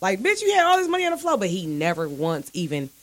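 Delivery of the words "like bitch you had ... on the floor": high incredulous quote; retold call-out. Woman retelling questioning her ex about money, high incredulous. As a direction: replay the question to him, pitched way up. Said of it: "But I did ask him, like".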